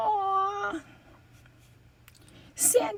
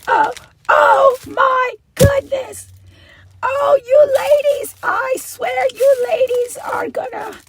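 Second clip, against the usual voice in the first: overwhelmed; 3 semitones higher, 8 dB louder than usual. Real, not acted. Overwhelmed by gifts.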